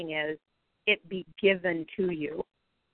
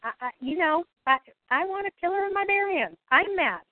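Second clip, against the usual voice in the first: owning the anger; 8 semitones higher than usual. Acted.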